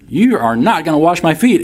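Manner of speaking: high, insistent stress on NOT; flat-out refusal with NOT punched high; emphatic refusal. Acted. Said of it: "Talk about emphatic".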